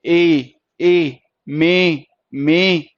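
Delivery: ए held long, then inside मे; long vowel. Shown as a demonstration. Teacher showing the sound in the word, long ए. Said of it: "ए की आ रही है ना".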